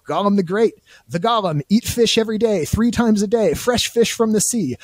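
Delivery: high, gloating Gollum voice; Gollum boasting. Retold or imitated. Host reading Gollum's fish fantasy, high and gleeful. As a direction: crow over the fish dreams, high. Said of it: "He says".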